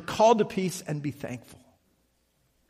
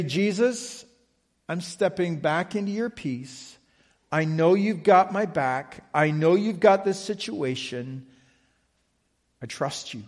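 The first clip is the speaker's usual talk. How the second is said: a quiet, trusting prayer, much softer; 4 semitones lower, 5 dB quieter than usual. Shown as a demonstration. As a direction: hush down and hand it over to Jesus.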